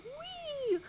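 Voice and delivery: very high squeal of delight; girl thrilled as the log flume starts; mock whee. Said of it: "at first she's excited".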